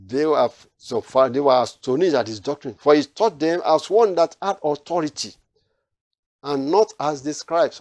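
ordinary talk

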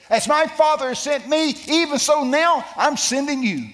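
high, loud preacher's shout; full-force proclamation. Retold or imitated. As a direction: cry out the commission to the crowd. Proclaims Jesus' words at full force.

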